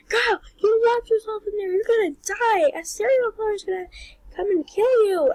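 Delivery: high, panicky self-scolding with a cry in it; shrill-edged panic in the car; panicked inner voice. Retold or imitated. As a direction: freak out at yourself, voice up high. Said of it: "and I was like".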